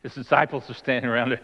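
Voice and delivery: normal talking voice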